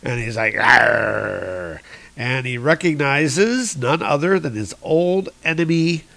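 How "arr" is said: low, drawn-out "arr"; comic-book growl, pitched low; imitated angry growl. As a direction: growl it long, mock angry. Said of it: "Thor looking angry here".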